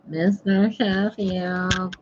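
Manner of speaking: high, slow, drawn-out comic call; comic put-on voice. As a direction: stretch the name out in a silly high voice. Joke voice after promising grown-up voice.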